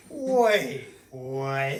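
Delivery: mock-shocked, loud and drawn out; dad's stunned disbelief. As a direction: imitate a dad doing a double take. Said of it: "my dad was like".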